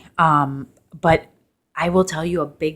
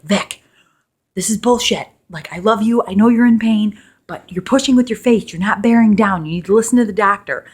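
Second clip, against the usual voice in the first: higher, louder exasperated husband; husband scolding; 6 semitones higher, 6 dB louder than usual. Retold or imitated. Husband telling his wife to push properly, raised and loud. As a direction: scold her to bear down.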